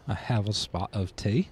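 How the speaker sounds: hammed-up, soft British accent with rising end; bad British accent. Joking back to the in-laws, bad British accent. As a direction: try a clumsy posh-tea British accent. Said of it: "they love speaking in a British accent".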